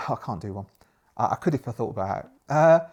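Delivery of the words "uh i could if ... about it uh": ordinary talk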